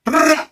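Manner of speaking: high rolled trill to summon sheep; trilled herding cry, pitched high; sheep-calling trill. Retold or imitated. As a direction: imitate a shepherd's far-carrying call. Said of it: "ఎంత దూరం నుంచైనా".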